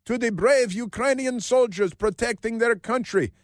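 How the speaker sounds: high mock-orator with put-on accent; Josh Shapiro. Host quoting the governor's rally speech, mocking put-on accent. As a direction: declaim in a caricatured speechmaking accent. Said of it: "Why did he speak with that accent?".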